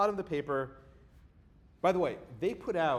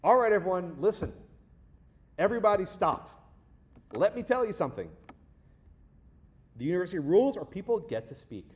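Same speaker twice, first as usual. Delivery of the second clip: raised, louder lecturing voice, higher; retold administrator addressing crowd; 4 semitones higher, 6 dB louder than usual. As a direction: imitate the administrator calling the room to order, loud.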